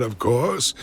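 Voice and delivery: usual speaking voice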